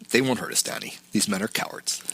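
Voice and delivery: calm a scared friend softly; gentle reassurance. Retold or imitated. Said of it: "Walter's tone is gentle".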